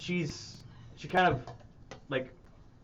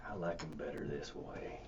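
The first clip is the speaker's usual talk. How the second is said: low quiet NPC voice; 11 semitones lower, 14 dB quieter than usual. Acted. GM voices Jeremiah.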